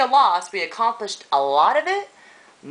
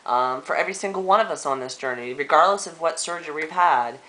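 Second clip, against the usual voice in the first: ordinary delivery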